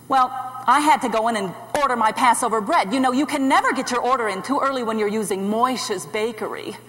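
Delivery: usual speaking voice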